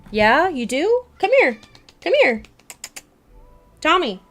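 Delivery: high, loud calling to a pet; calling the cat. Real, not acted. Streamer calling her cat Tommy over, high and loud. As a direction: call the cat across the room.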